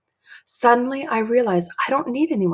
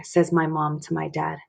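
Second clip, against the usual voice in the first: ordinary delivery, nothing marked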